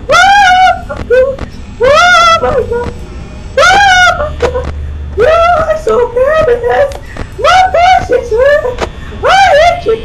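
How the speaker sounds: very high, wailing shrieks; panicked screaming. Real, not acted. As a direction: scream and plead in sheer terror. Jump scare, panicked screaming at game.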